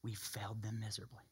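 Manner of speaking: soft unvoiced whisper, heavy with regret. Real, not acted. Preacher whispering regret to his congregation. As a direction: breathe it like a confession. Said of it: "whispered again".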